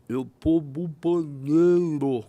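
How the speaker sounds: imitating a stroke victim's slurred speech. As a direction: drag the words out thick and slurred. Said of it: "Falou com a voz enrolada".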